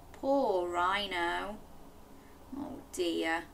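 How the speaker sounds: slow, soft pity; softly pitying reader; sympathetic. Acted. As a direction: feel sorry for him. Sympathy for sad rhino.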